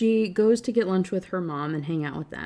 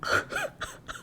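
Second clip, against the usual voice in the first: a high, breathy sob that turns into a laugh; real sob-laugh; teary sob-laugh; 5 dB quieter than usual. Real, not acted. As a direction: break into a crying laugh. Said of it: "That is so sad".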